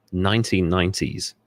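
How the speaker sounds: ordinary talk, nothing marked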